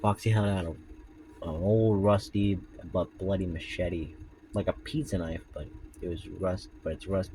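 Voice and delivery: low, soft story reading; story narration. A narrator's reading. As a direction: read the tale in a hushed voice. Reads Foxy story aloud.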